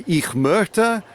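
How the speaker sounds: tentative German attempt; searching for German words; halting German. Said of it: "I was wanting to communicate to this gentleman".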